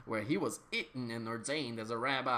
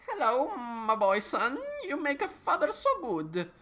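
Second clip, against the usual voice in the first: high, strange put-on old man; squeaky unnatural voice, much higher; 12 semitones higher than usual. A narrator's reading. Old man in the lab greeting Harry, high and odd.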